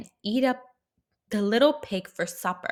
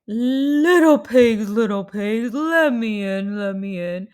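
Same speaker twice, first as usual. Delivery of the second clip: big bad wolf calling; 8 dB louder than usual. Wolf knocks at door.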